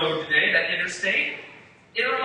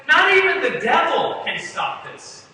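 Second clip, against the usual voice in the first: bold declaration; 9 semitones higher, 7 dB louder, slower than usual. Acted. Preacher voicing Jesus' bold promise.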